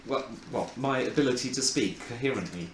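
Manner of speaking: low, worn-out, drowsy mumble; tired. Real, not acted. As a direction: sound tired and run down. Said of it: "I'm definitely tired today".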